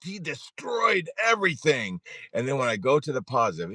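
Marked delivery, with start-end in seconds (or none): 0.0-2.0 high, slow, shouted mock panic; mock panicked inner voice. Mind narrating disaster to himself, in a high, hammed-up panic. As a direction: play the catastrophizing mind freaking out. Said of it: "our minds go".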